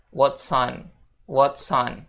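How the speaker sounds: "what's on" slow, fused into "whatson"; linked what's on. Shown as a demonstration. Teacher models the link slowly. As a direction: glide "what's" into "on". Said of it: "bisa sambung antara what's sama on".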